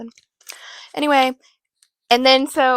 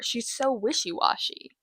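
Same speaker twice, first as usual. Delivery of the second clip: ordinary talk, nothing marked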